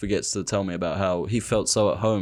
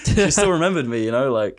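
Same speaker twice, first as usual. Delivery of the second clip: normal talking voice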